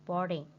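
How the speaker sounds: "Boarding" with BOARD strongest, falling away after; first-syllable stress. Shown as a demonstration. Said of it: "straight mark on the first syllable like this".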